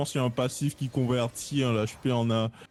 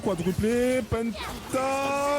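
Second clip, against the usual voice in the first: excited; 11 semitones higher, much slower than usual. Real, not acted. Counting up the kills.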